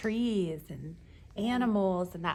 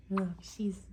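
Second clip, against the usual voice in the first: ordinary talk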